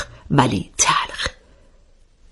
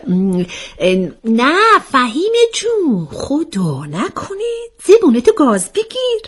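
slow hesitant ums, then high protest; stumble, then deny it in a drawn-out high voice; flustered stammering; 11 semitones higher, slower than usual. A narrator's reading. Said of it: "عاطفه دوباره به تته پته افتاد".

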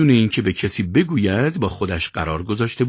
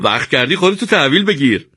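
mocking, higher-pitched jibe; sneering; 6 semitones higher, 4 dB louder than usual. A narrator's reading. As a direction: needle him with a smirk. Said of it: "پوزخند زد و گفت". (طعنه با پوزخند)

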